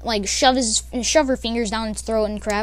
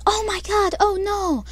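a higher, lightly voiced gasp of concern; mock worried ladies; 8 semitones higher than usual. Classmates react to the cat attack: mock worried ladies. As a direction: play the fretting girls, pitched up. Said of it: "the ladies were like".

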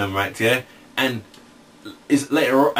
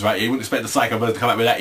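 usual speaking voice

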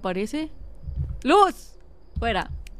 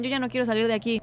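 ordinary talk

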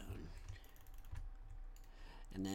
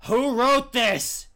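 mock outburst; 11 semitones higher, 16 dB louder, much slower than usual.

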